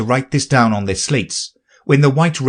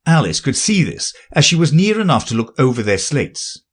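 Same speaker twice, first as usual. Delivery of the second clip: plain reading voice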